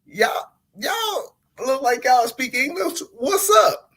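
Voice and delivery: high, slow eager hello rising to a squeak; excited to find English speakers; over-eager newcomer greeting. Retold or imitated. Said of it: "I was like".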